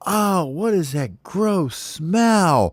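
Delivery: high, slow fake-innocent whine; feigned disgust. Baby bird pretends he just woke up, feigned disgust. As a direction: overplay the surprise to hide your guilt. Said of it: "pretends he wakes up".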